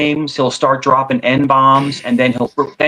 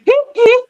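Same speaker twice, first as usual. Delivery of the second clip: very high imitated giggle; high-pitched mock giggle; 4 dB louder than usual. Cohost imitating the troll's laugh, very high mock giggle. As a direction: squeak out the troll's little laugh. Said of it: "he has, like, a really high-pitched voice".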